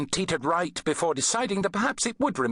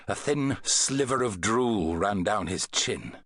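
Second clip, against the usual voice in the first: ordinary delivery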